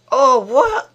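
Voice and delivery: high, loud disbelieving cry; stunned, indignant yelp; shocked outburst. Real, not acted. Reacts in shock to match event.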